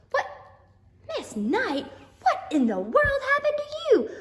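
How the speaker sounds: high, loud shocked question; hammed-up shock; mock astonishment. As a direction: pretend outrage at your own mess. Teacher mock shocked at shoe.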